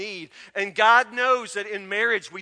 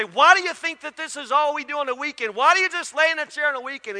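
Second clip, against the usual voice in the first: higher, louder mock nagging wife; retold wife's nagging, raised and louder; 5 semitones higher, 5 dB louder, faster than usual. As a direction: needle him like a fed-up wife. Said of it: "she'd come in and say".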